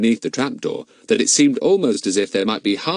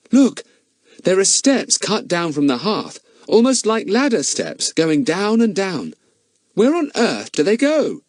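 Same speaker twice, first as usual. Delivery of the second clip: higher, eager discovery voice; excited boy Larry; 11 semitones higher, 3 dB louder than usual. Acted. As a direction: point down the hole in wonder.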